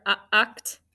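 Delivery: the short u alone, then "uct"; isolated sounds. Shown as a demonstration. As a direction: pick out "uh", then join it as "uct". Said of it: "What kind of syllable is".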